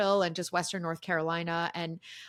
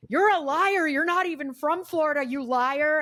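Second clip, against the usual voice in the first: higher, louder mock accusation; mock angry accusation; 8 semitones higher, 5 dB louder than usual. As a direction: jab at her like a troll. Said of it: "he was like".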